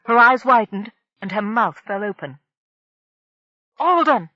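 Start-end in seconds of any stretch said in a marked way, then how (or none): 3.8-4.3 high, loud shriek of a name. A narrator's reading. Marina seeing the brain ship revealed, shrieking high and loud. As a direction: scream it at the top of her voice. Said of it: "she shrieked at the top of her voice".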